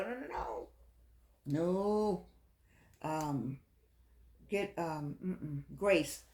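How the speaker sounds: ordinary delivery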